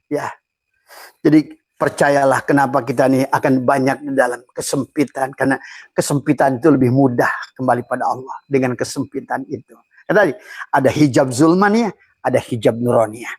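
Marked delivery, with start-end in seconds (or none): none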